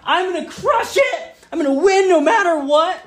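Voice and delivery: put-on eager kid. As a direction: brag like a kid sure to win. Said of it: "we're gonna be like".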